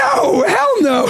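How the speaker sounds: loud, high laughing protest; emphatic denial. Real, not acted. Dad laughing and shouting a denial to his friend.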